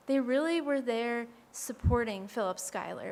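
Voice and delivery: ordinary talk, nothing marked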